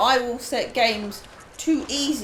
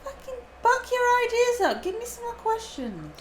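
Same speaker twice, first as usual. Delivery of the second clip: high-pitched, hammed-up whining appeal; mock pleading; 11 semitones higher, slower than usual. Streamer begging viewers for more questions, mock pleading. Host begs chat for questions.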